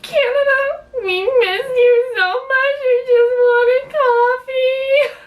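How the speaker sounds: high-pitched, hammed-up tearful wail; mock crying. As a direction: wail it slowly, as if overcome with homesickness. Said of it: "we almost cried".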